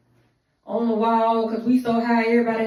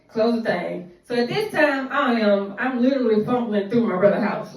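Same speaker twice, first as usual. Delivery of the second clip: ordinary talk, nothing marked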